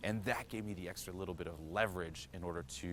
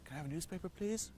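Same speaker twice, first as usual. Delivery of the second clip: higher, softer nervous ask; higher pitched nervous plea; timid request; 10 semitones higher, 3 dB quieter than usual. Retold or imitated. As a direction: squeak it out shyly. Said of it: "I'm like".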